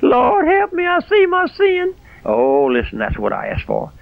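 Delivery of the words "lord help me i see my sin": crying out to the Lord. Pretend. Preacher voicing a repentant sinner crying out to the Lord, high and sobbing. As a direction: wail it like a sinner begging to be saved. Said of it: "You'll come back crying".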